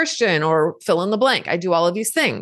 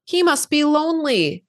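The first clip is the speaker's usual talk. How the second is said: worried little inner part; 7 semitones higher than usual. Retold or imitated. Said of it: "raises her little head and goes".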